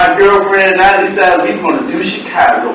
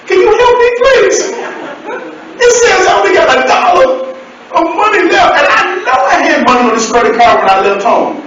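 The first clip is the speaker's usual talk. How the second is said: much higher, pleading mama voice; 12 semitones higher, faster than usual. Retold or imitated. To the phone operator, high pleading mama voice. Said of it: "I used my mama voice".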